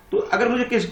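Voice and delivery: ordinary delivery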